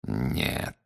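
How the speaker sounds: low, soft, drawn-out answer; slowly. A narrator's reading. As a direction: stretch the single word ominously. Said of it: "медленно ответил Грюм". (медленно, низко и тихо)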